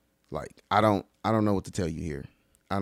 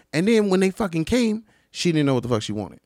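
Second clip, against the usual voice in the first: ordinary talk, nothing marked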